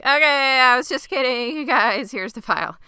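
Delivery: high, loud mock pleading; panicked backing down. Threatened man surrendering to the women, mock panicked.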